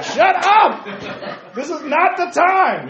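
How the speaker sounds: laughing mock scolding. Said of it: "I'm like".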